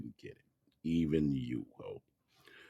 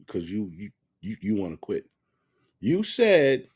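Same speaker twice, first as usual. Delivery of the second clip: usual speaking voice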